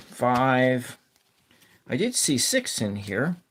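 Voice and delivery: ordinary talk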